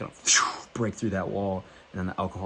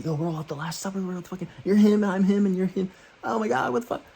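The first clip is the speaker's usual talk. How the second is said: high-pitched frantic amazement; hammed-up panicky excitement; mock freak-out; 11 semitones higher than usual. Acts out his freaked-out high self.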